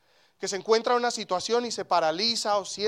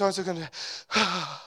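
sigh. Shown as a demonstration. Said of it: "es un suspiro".

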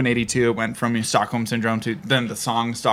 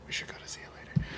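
hushed whisper; 18 dB quieter than usual. Real, not acted. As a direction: whisper it back secretly. Echoes the whisper back.

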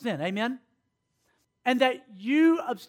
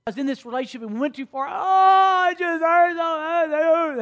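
mock crying girl; 4 semitones higher than usual. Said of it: "come to me and say".

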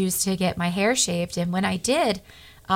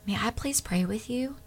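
hushed, voiceless ask; whisper; 2 semitones higher, 10 dB quieter than usual. Retold or imitated. Whispering to a frail woman in the cancer lobby. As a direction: whisper it gently so the lobby can't hear. Asking a patient to pray.

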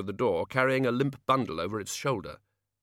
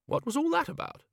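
much higher, baffled wizard. A narrator's reading. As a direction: ask in bewilderment. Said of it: "said Ridcully".

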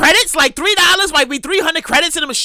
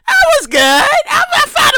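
excited; 12 semitones higher, 3 dB louder, slower than usual. Retold or imitated. Proud gambling high.

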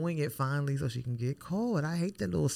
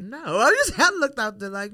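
loud and higher, indignant; exclaiming; 6 semitones higher, 9 dB louder than usual. Real, not acted. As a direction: blurt it out in disbelief. Indignant retelling reaction.

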